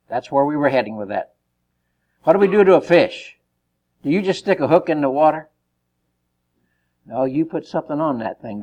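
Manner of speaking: ordinary talk, nothing marked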